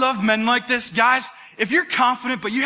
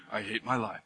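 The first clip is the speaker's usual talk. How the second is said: much lower, much softer gloomy mumble; mock despair; 13 semitones lower, 12 dB quieter than usual. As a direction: sink into a hammed-up low. Despairing quote of Elijah.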